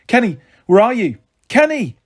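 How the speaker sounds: high, loud panicked calling. A narrator's reading. Girl searches the market calling her brother, high panicked shout. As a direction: shout for him down the alley.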